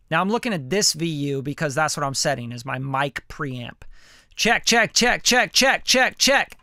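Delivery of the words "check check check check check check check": mic check. Shown as a demonstration. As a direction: push the check into the meter.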